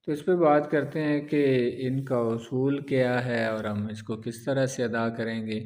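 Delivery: usual speaking voice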